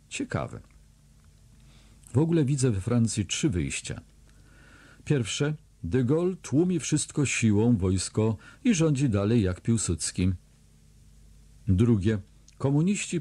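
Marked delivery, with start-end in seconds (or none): none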